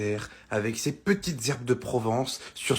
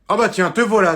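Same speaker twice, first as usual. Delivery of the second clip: angry mum; 6 semitones higher, 7 dB louder than usual. Acted.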